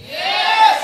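a very high, yelped yes; high excited cheer to the room; hype shout. Real, not acted. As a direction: fire up the crowd. Hyping the business audience.

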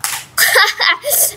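high burst of laughter; real quick cackling laugh at a mishap. Real, not acted. Laughs at a backstab.

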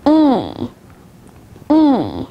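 long, held "e" as in leaf; isolated "e", drawn out like the start of evening; long vowel demo. Shown as a demonstration. Teacher showing learners long vowels, "e" held long. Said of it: "Now let's move on to long vowels".

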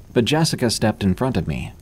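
ordinary delivery, nothing marked

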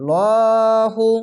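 slow drawn-out tajweed chanting; Quran recitation. Shown as a demonstration. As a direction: recite it slowly, stretching the vowels. Recited word.

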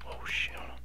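sudden hushed oh shit; whispered alarm; startled whisper. Real, not acted. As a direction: breathe it out in shock. Startled by the monster.

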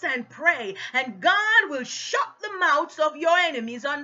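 loud, punched key words, driving exhortation; fired-up sermon delivery; forceful preaching. Real, not acted. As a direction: preach it hard to the congregation.

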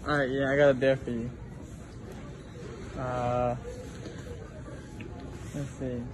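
muffled behind cloth mask; speaking with mouth covered; through a face mask. Real, not acted. Seen 0: Both visible people wear cloth face masks throughout the words.

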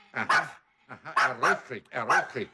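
ordinary talk, nothing marked

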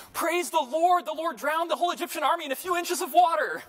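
very high, loud shout of praise; triumphant joyful shout. Retold or imitated. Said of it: "He goes".

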